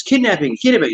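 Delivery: high, alarmist kidnapping; mock outcry of everyone. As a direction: parrot the panicked crowd. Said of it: "Everyone's like".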